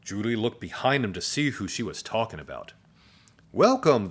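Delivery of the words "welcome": high, loud cheery greeting; friendly welcome. A narrator's reading. Teacher welcomes the new pupil, friendly and high. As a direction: welcome them in with a high, strong voice. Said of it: "the friendly voice continued".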